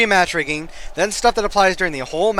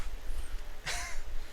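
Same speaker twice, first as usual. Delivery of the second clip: high laugh; 13 dB quieter than usual. Real, not acted. Laughing at the result.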